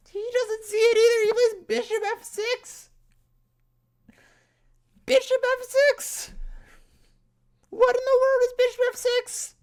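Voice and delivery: very high, loud squeal of disbelief; incredulous shrieking. Real, not acted. Player reliving the missed bishop f six, incredulous shriek. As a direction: shriek it out, voice shooting way up.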